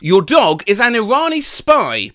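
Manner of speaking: normal talking voice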